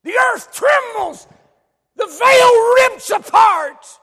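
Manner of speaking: slow, high, roaring shout; drawn-out, full-force pulpit shout; thundering preaching. Real, not acted. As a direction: bellow each word like the ground is splitting.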